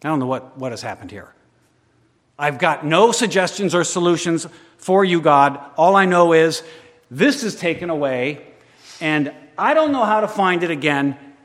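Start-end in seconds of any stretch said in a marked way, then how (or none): 2.4-11.3 loud, higher cry to God; loud preached lament. Acted.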